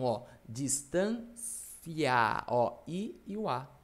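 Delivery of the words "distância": "distância" very slow, the final i and a drawn out; sounded-out diphthong. Shown as a demonstration. Teacher showing the class both vowel sounds, sounded out slowly. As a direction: stretch "distância" so each vowel of -ia is heard. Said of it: "como assim essa questão do som".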